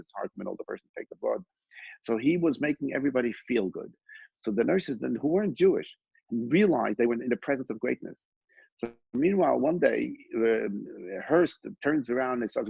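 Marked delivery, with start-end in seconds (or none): none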